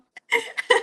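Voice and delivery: embarrassed high giggle. Real, not acted. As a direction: giggle at your own joke. Embarrassed laugh after joke.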